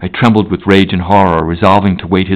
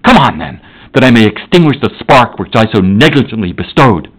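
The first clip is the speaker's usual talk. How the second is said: boundless rage; 5 semitones higher, 5 dB louder than usual. Acted. Victor cursing the creature he made, in loud rage. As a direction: spit out the curses at the fiend in rage. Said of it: "My rage was without bounds".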